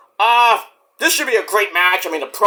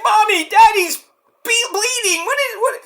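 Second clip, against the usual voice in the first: put-on confused little kid; 14 semitones higher than usual. Toddler telling mommy daddy is bleeding, high and confused. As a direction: stammer like a shocked toddler.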